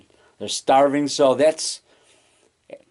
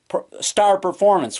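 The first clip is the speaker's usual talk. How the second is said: ordinary delivery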